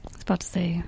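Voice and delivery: hushed aside under the breath; breathy whispered aside. Real, not acted. Unvoiced aside.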